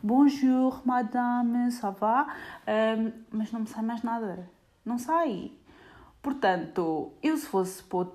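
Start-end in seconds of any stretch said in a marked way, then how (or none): 0.0-2.3 put-on a bright, higher French hello in a French accent; French greeting. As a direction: chirp it like a proud French phrase. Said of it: "Sai-me um monsieur".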